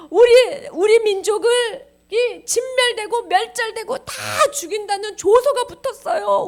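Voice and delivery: high, loud, tearful begging; crying plea. Acted. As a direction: plead with the king in hammed-up sobs. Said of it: "울면서 말하는 거야". (울면서 애원하는 연기)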